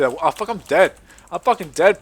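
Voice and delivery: ordinary talk, nothing marked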